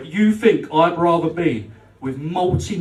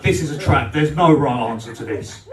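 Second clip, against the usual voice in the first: usual speaking voice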